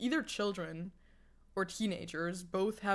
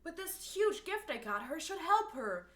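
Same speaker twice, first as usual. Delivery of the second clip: acted teacher; 5 semitones higher, 6 dB quieter than usual. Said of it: "the teacher in the background".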